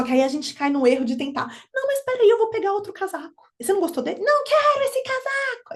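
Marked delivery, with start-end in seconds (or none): 1.7-4.2 high, eager pleading mother; coaxing parent. Retold or imitated. A mother begging her child to take another coat, high and eager. 4.2-5.7 put-on high, whiny child refusing. A small child refusing the coat to mother, high and whiny.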